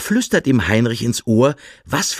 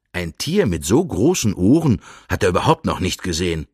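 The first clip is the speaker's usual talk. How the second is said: ordinary delivery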